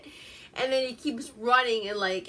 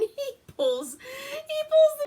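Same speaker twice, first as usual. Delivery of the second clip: high squeaky laugh through the words; real laughter breaking the sentence; 5 dB louder, much slower than usual. Real, not acted. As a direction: crack up while trying to tell it. Breaks up laughing while telling.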